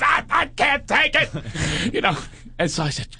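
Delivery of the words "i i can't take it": high, drawn-out queeny wail; over-the-top campy meltdown; Paul Lynde. Retold or imitated. Imitating a friend's panic at being pulled over, queeny meltdown. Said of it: "He's turning into Paul Lynde on steroids".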